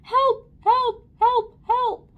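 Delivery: high, loud repeated cries; yelling for help. A narrator's reading. Man running in circles, yelling for help. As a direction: yell each word in panic.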